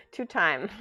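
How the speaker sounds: normal talking voice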